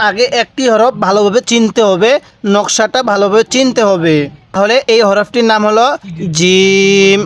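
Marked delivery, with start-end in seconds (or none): 6.4-7.2 firm "j", then a long level "eem" in জিম; hard জিম. Shown as a demonstration. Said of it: "শক্তভাবে উচ্চারণ করব".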